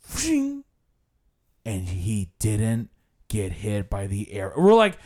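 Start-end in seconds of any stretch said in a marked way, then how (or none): 0.1-0.6 whooshing arrow sound effect; mouth-made "fshing" whoosh; imitated arrow noise. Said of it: "he went like". 1.7-4.5 low, measured mock storytelling; imitated guide, lower and portentous; dramatized tour guide. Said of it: "This is how he talked".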